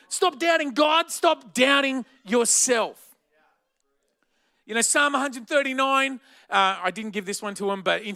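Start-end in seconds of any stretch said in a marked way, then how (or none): 0.1-3.0 loud, high, rising call to stop doubting; forceful preaching. Acted. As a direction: preach it hard at the crowd.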